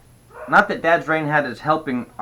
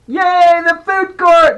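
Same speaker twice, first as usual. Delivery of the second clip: put-on very high, loud kid's cheer; excited shout; 10 dB louder, slower than usual. A narrator's reading. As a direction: cheer at the top of your voice. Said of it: "the pain shouted".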